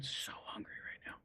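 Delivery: whispered aside, no voice at all. Real, not acted. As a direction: breathe it to yourself, unvoiced. Aside about being hungry.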